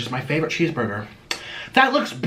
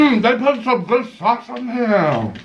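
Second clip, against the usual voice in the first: savouring; 6 semitones higher, slower than usual. Real, not acted. Tasting burger, savouring.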